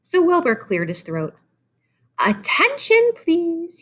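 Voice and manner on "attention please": loud firm call, much higher. A narrator's reading. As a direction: call the barn to attention. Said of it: "he said in a loud, firm voice".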